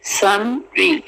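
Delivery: normal talking voice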